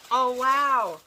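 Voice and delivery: loud, high burst of amazement; amazed. Real, not acted. Opening a friend's gift, loudly amazed.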